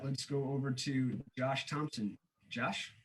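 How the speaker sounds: normal talking voice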